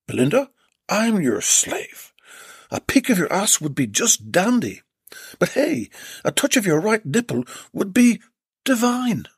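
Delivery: a smitten suitor; Jed's voice. Acted.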